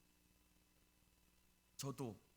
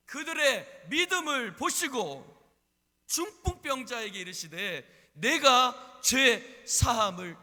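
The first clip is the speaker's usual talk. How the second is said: loud, high, drawn-out preacher's delivery; preaching at full force; 9 semitones higher, 13 dB louder, slower than usual. Real, not acted. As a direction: proclaim it slowly with full force. (힘껏 외치는 설교조)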